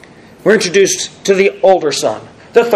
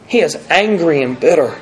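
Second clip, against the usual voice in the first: normal talking voice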